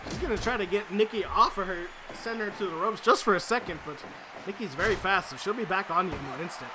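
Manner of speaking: high, loud excited wrestling commentary; live play-by-play. Acted. Commentator calling the match for the viewers, excited play-by-play. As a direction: call every move like a ringside announcer.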